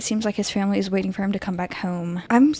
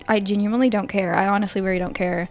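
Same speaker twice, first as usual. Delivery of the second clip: usual speaking voice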